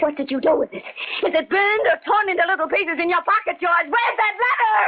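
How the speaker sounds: desperate near-sob, then cry out the demand; frantic distress. Acted. Sybil demanding the letter from George, tearful shout.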